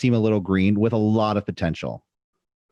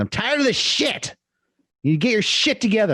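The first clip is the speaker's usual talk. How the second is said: rip them apart, pitched way up; mock fed-up wrestler rant; imitating an angry tirade; 12 semitones higher than usual. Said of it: "Jericho rips him apart".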